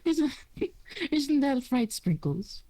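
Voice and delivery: high, cheerful elf greeting; gentle forest elf. Acted. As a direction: welcome the stranger brightly, up high. Silksong talks to bird.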